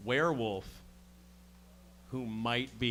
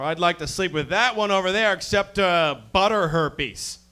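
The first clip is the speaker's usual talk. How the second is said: higher and louder, crude bro; 6 semitones higher, 7 dB louder than usual. Retold or imitated. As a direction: play the crude bro again. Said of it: "I gotta be like".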